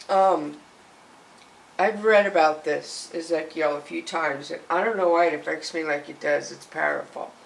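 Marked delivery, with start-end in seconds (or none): none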